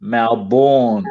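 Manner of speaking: "Melbourne" said as MAL-born, a long open first vowel and a full "born". Retold or imitated. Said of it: "as he would say".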